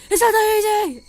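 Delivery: high, very loud outburst; exasperated shout. Retold or imitated. Artist told to just redo destroyed art, shouting in frustration.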